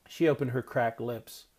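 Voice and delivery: usual speaking voice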